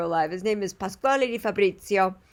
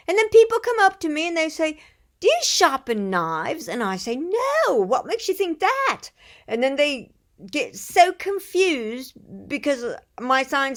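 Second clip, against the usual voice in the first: higher-pitched, animated British imitation; British accent; 6 semitones higher, 4 dB louder than usual. Knife sharpener telling of market customers, British accent. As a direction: do an English tradesman's accent, pitched up. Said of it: "I think he's English".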